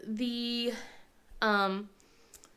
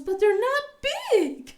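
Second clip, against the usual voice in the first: put-on higher, slow complaining kid voice; whiny kid; 12 semitones higher, 4 dB louder, slower than usual. Son complains gifts are small, higher whine. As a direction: whine it like a let-down boy. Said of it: "He goes".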